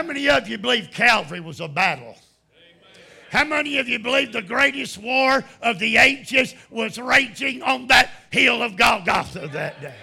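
loud, high, shouted preaching; preaching at full force. Acted. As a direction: thunder it out to the congregation.

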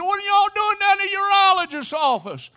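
high, loud quizzical question; curious questioner. Pretend. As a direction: ask in an incredulous high voice. Imagined listener asking.

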